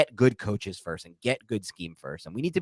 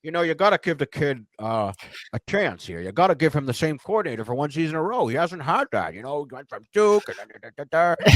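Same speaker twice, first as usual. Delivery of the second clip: higher, mock-earnest GM defending his quarterback; Dave Gettleman impression; 6 semitones higher than usual. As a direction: play the stubborn general manager pleading for his kid QB. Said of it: "Your Dave Gettleman".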